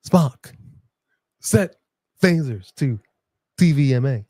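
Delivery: halting starship captain, big pauses; Captain Kirk. Acted. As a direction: break every phrase off dramatically, word by word. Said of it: "say that in the Captain Kirk voice".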